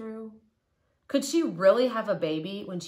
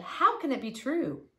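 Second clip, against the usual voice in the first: amazed Abraham; 8 semitones higher than usual. A narrator's reading. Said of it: "Abraham said".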